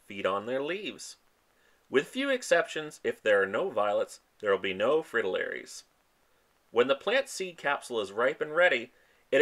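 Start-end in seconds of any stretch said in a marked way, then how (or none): none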